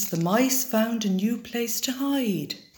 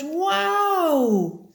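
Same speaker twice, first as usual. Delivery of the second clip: many owls marvelling together; owls in wonder; 9 semitones higher than usual. A narrator's reading. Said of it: "and all the owls said".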